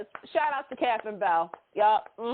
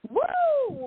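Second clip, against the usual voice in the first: high-pitched excited whoop. Retold or imitated. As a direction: let out a sudden delighted whoop. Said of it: "I was like".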